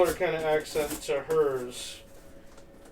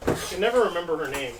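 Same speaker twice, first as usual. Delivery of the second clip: normal talking voice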